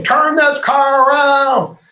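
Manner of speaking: high, loud and slow mock outburst; imagined angry demand. The complaining passenger imagined snapping at the driver, hammed-up loud. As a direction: ham up a passenger's huffy demand. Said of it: "I was waiting for him to say".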